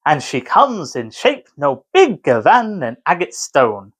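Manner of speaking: slow, bouncing iambic beat through "She is the fairies' midwife"; slow, rhythmic verse recital; slow iambic reading. Shown as a demonstration. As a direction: read it slowly so every stressed syllable lands. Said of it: "I did deliberately slow that".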